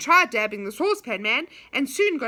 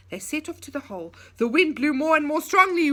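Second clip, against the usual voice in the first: usual speaking voice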